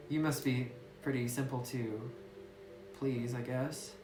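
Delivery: ordinary delivery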